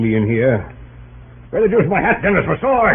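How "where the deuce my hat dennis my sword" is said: higher, hurried and flustered; a general caught unready, rushing; flustered hurry. Acted. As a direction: scramble for hat and sword with a laugh. Grant rushing to meet Lee.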